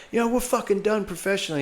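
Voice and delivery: fast, high mock British rant; British accent. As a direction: rattle it off in a posh British accent. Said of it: "his American accent was fading".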